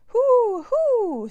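very loud, high, drawn-out hoots; angry owl hooting at full volume. A narrator's reading. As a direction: hoot sharply like an indignant owl. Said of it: "said the owl angrily".

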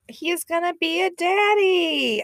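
mock gushing in a high voice; emotional Josh. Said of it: "he's predictably emotional".

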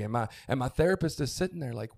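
ordinary delivery

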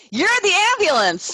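teasing a friend with a high mock retort; mock exasperated wife. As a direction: jab at the husband in a high put-on voice. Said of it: "You're like".

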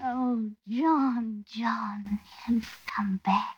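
breathy unvoiced whisper. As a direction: whisper his name in relief. Whispering as he returns.

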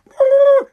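high, loud sustained woo; mock "woo" whoop. Dad gloating as the dog does tricks, mock whooping.

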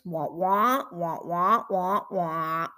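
muffled trombone-like wah wah; muffled wah wah talk. Retold or imitated. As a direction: Peanuts adult voice, muffled and droning. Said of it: "that adult voice".

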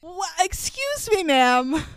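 a startled, disbelieving yelp; sharp high disbelief; shocked. Real, not acted. Shocked reaction.

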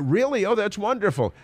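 high fake delight; gushing with fake delight; mock gushing. Oprah voiced gushing.

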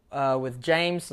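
ordinary talk, nothing marked

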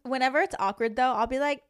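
ordinary delivery

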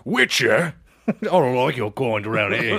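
put-on British villager voice; Witcher blacksmith. Blacksmith unwelcoming to the Witcher, hammed-up British accent. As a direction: play a suspicious game blacksmith with a mock British accent. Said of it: "a blacksmith in The Witcher".

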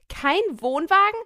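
outraged disbelief. Acted. Outraged model voiced.

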